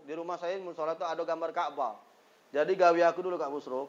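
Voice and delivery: ordinary delivery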